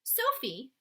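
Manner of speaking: ordinary delivery, nothing marked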